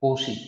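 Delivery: Cauchy as "KOH-shee", softly; correct pronunciation. Shown as a demonstration. Teacher giving the pronunciation of this quantity, Cauchy as "KOH-shee". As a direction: say Cauchy with a sh sound in the middle. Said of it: "This is the pronunciation of this".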